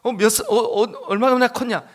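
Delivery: a touch of a stern official; interrogating ruler. Retold or imitated. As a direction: quiz them like a stern official. Voicing the Egyptian ruler.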